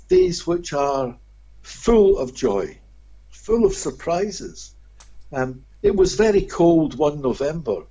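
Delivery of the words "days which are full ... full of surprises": slow, savoured. Real, not acted. Savouring the good days.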